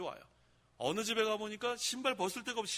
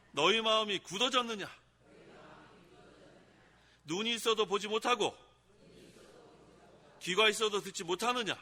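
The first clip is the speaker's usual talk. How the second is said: recited together; 3 semitones higher, 5 dB louder than usual. Shown as a demonstration. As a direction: lead the congregation line by line. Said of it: "같이 한번 따라해보세요".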